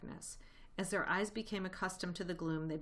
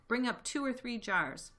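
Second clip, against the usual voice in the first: higher-pitched call down the stairs; called back; 7 semitones higher, 4 dB louder than usual. A narrator's reading. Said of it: "the other voice called back".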